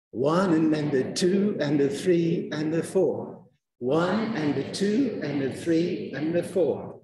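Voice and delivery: numbers stressed, little words squeezed between; stress-timed rhythm with fillers; stress timing beat. Shown as a demonstration. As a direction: keep the beat on one two three four. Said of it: "it's called stress timing".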